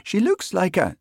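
high, lilting island voice, left unfinished; soft Western Isles lilt. A narrator's reading. Constable sizing up cat, soft Western Isles lilt. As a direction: break off mid-remark in a Hebridean lilt. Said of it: "in a soft voice delicately laced with an endearing lilt".

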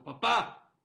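loud anguished cry of papá; desperate shout. Acted. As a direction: call out to the father in despair. Rich son desperate to father.

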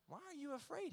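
"afraid" swept up high in disbelief; mock-amazed high rise on "afraid"; incredulous question. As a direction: ask "Why are you afraid?" with a high rising "afraid". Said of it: "he says".

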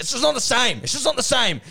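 high-pitched, exaggerated wail of protest; mock protest. Carey rejecting a secondhand Brownlow, theatrical protest. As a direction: protest it twice, pitched right up. Acted Carey whining over eBay medal.

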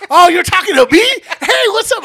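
high, very loud, overjoyed greeting; delighted loud realisation. Retold or imitated. Pierre realises the stranger means him, loud and thrilled. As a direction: burst out thrilled to be noticed.

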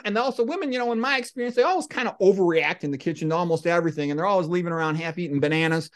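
ordinary talk

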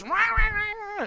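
high, wordless yelling in bursts; hammed-up road-rage yell; mock yelling fit. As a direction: bark it like an enraged driver. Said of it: "It's real yelling fit".

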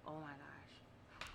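very soft, slow imitated shock; retold stunned hush. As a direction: breathe out his quiet disbelief. Retells his stunned reaction.

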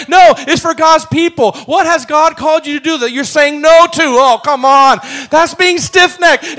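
loud, high, rousing sermon delivery; full-force preaching. Acted. Pastor pressing the congregation to stop resisting God, loud and rising. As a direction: preach it out at full strength to stir the room.

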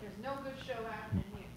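distant, faint voice off mic; speaking from the next room; from another room. Real, not acted. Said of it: "Ready from the other room".

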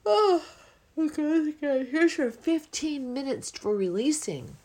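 high, loud, cheery voice of the yawn itself; playful yawn voice. Pretend. The yawn inviting everyone to release, bright and high. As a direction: play the yawn handing out release time. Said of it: "the yawning is happening to encourage everyone, like".

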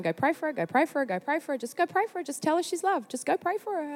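rapid repeated urging, pitch climbing; insistent nagging prompt. Retold or imitated. God urging a reluctant woman back inside, insistent.

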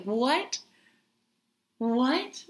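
high, incredulous question; astonished. Retold or imitated. Retelling her reaction to the lizard, astonished.

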